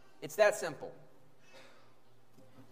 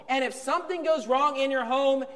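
ordinary talk, nothing marked

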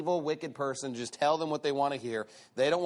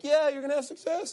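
mocking; 9 semitones higher than usual. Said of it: "he just mocks".

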